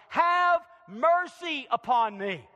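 loud, high, drawn-out plea; crying out. Acted. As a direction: cry out slowly to God for mercy. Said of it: "the first words David cries out".

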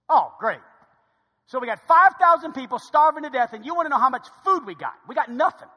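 loud, mock-outraged complaint; sarcastic exasperation. Disciples with nothing, told to count loaves, sarcastic exasperation. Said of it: "they get sarcastic".

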